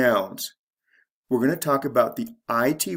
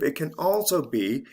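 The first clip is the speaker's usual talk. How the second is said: usual speaking voice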